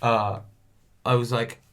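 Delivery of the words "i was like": ordinary talk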